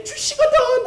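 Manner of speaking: very high, eager call; bold Peter cry. Acted. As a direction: burst out boldly as Peter. (당돌하게 높이 외치는 베드로)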